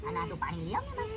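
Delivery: whisper-soft to keep him calm; hushed plea to the friend for water. Acted. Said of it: "ધીમે, ધીમે".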